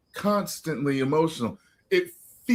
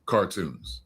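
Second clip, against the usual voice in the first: normal talking voice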